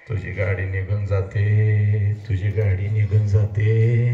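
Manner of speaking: ordinary delivery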